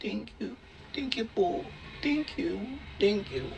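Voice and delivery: soft, drowsy "Thank you" murmured again and again; breathy sleepy thank-yous under the breath; groggy whispered thanks. Retold or imitated. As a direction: mumble thanks half-conscious after anesthesia. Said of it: "Gumaganon daw ako".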